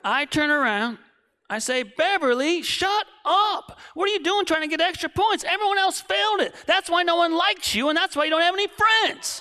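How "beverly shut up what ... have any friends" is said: high, loud tirade that keeps going; yelling. Retold or imitated. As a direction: lay into her without stopping. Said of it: "I yell at her".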